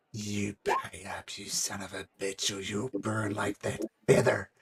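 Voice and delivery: low, quiet threatening thug; menacing thug. Acted. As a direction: lean in and demand the money softly. Golfer threatens.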